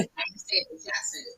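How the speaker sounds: soft unvoiced whisper; whispered repeat. Real, not acted. Repeating the chat disclaimer, a teasing whisper. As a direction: say it again under your breath.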